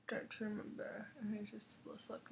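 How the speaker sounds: soft whispered aside to herself; whispering. Real, not acted. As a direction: drop to a private whisper. Whispered aside while checking products.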